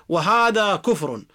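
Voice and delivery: normal talking voice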